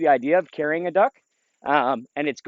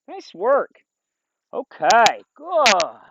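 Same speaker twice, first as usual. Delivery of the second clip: upbeat praise; 5 semitones higher, 5 dB louder, much slower than usual. Real, not acted. Praising dog on duck.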